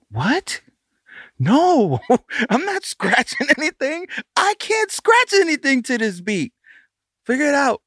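high-pitched, hammed-up protest; indignant refusal. Producer acting out refusing to scratch the beat, indignant. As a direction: imitate a DJ balking, voice pushed way up.

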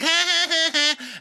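high mock giggle; giggling. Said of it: "giggling up the stairs".

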